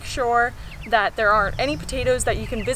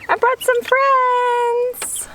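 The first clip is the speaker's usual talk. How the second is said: cooing pet talk; 13 semitones higher than usual. Real, not acted. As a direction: coo it up high like to a pet.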